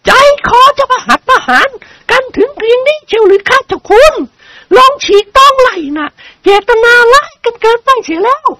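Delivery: high-pitched aristocratic lady, indignant. A narrator's reading. Lady character in novel reading. (เสียงคุณหญิงแหลมสูง ไม่พอใจ)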